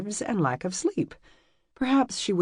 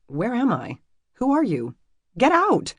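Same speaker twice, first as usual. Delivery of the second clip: climbing higher and louder to a shriek; rising panic; 8 semitones higher, 4 dB louder than usual. Acted. Emmy realizing she is somewhere strange, panic to a yell.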